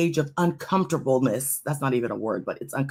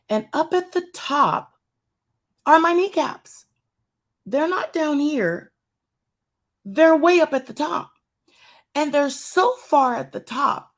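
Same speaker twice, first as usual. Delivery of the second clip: amazed emphasis; 8 semitones higher, 3 dB louder than usual. Retold or imitated. Amazed at kneecaps shown on screen.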